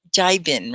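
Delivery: "Jibin" said JAI-vin; "Jibin" with a long I first syllable. Shown as a demonstration. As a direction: say "Jibin" with first vowel as in "eye". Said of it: "With the I".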